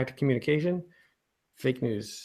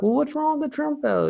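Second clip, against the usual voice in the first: high squeaky whine; 4 dB louder than usual. Retold or imitated. Retold exchange with cousin.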